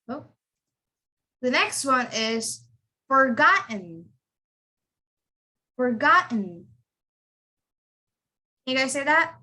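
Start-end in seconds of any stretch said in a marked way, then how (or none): 3.1-6.7 "forgotten" as forgot, catch, "n"; silent T. Shown as a demonstration. Teacher models forgotten for students, silent T. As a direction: swallow the T in "forgotten". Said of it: "That T becomes silent".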